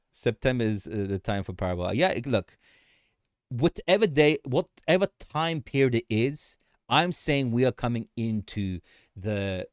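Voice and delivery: ordinary talk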